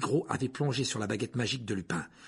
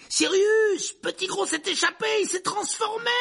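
much higher, urgent calling shout; 4 dB louder than usual. Acted. Harry calls to Sirius about the escape, shouting. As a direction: call out across the park in alarm. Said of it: "cria Harry".

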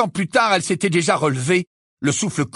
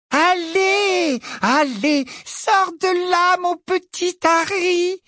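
put-on high, slow cooing baby talk; mock baby voice; much slower than usual. A narrator's reading. Bellatrix taunting Harry in a high, slow baby voice. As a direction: coo at him like a baby, mocking. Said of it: "en imitant une voix de bébé".

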